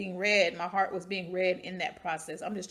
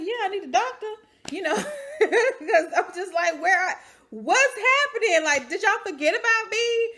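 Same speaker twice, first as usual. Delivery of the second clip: high and louder, laughing through it; exasperated plea; 12 semitones higher, 7 dB louder than usual. Retold or imitated. The patient begs the nurse for a doctor, high and laughing. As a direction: plead for a doctor, pitched up with a laugh. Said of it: "I said".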